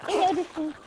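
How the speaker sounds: high squealing fangirl voice; excited anime fan. Pretend. Squealing over a game creature.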